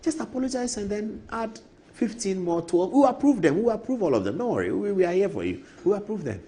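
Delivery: mock eager MP promising approval; imitating Afenyo again. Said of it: "And so Afenyo says".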